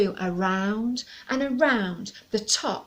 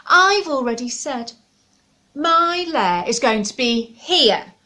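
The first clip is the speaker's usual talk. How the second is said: snarled; 5 semitones higher, 9 dB louder than usual. Acted. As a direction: growl it out possessively. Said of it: "snarled the stubborn dragon".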